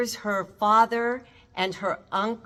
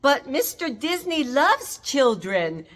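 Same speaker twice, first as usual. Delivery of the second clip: retold scream; 6 semitones higher, 4 dB louder, slower than usual. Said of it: "She screams out".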